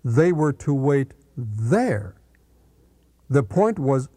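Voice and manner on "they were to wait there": slow, with "there" set apart and drawn out; stress on there. Shown as a demonstration. Preacher correcting a misreading of the verse, slow emphasis landing on "there". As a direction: pause slightly, then lean on "there". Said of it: "The emphasis is on the words".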